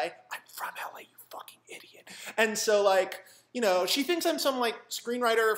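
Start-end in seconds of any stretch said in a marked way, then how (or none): 0.0-2.1 seething whispered aside. Retold or imitated. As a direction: hiss the retort under your breath. His suppressed inner retort.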